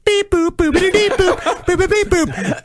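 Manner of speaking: high beep boop noises; phone dialing beeps. Retold or imitated. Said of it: "being like".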